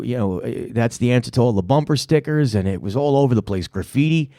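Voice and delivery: usual speaking voice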